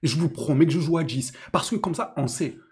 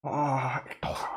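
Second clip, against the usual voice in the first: low, drawn-out sigh of despair; exasperated groan; 5 semitones lower, 6 dB quieter, much slower than usual. Real, not acted. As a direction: groan the name in disbelief. Despairing groan at the player's name.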